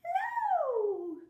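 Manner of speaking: hello shrieked way up high and loud; a squeaky, sky-high Hello!. Shown as a demonstration. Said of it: "as high as we can".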